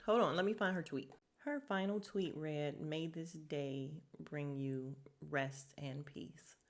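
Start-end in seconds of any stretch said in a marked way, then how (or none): 2.8-6.6 slow, low and hushed; sombre reading. Retold or imitated. Reading a late woman's final tweet to viewers, sombre and slow. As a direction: read it softly, with long heavy pauses.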